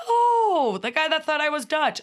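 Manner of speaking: high, loud burst of surprise on seeing him; a sudden oh, you again; surprised recognition. Retold or imitated. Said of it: "And I'm like".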